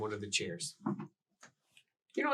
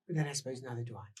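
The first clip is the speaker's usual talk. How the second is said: ordinary talk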